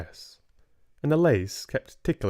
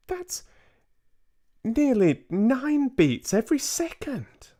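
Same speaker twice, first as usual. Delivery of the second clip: put-on higher, amazed boy's exclamation; excited cry. Boy mouse works out his heartbeat, crying out excited. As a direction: blurt it out in amazement. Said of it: "I cried".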